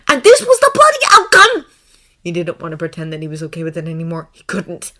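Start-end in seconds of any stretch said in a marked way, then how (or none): 0.1-1.6 high, very loud climax; outraged narration. A narrator's reading. Narrator at peak of Draco's resentment, outraged shouting. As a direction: cry it out in fury.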